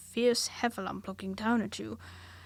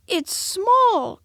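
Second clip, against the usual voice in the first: much higher, louder cry; cried out; 10 semitones higher, 12 dB louder than usual. A narrator's reading. Piglet crying to Pooh, high loud cry. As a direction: squeal it out. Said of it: "cried Piglet".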